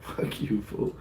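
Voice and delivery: whispered insult at the teacher; whispered taunt. Retold or imitated. Boy glaring at the teacher, a whispered mock insult. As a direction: hiss it so only you hear it.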